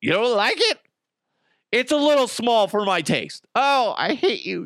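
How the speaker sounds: high mocking girl voice; mock girlfriend. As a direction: sneer it in a high girly voice. Acted girlfriend voice.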